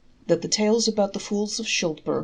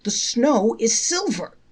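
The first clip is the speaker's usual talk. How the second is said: slow, higher, louder exclamation; exclaiming; 5 semitones higher, 5 dB louder, slower than usual. A narrator's reading. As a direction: announce a great discovery.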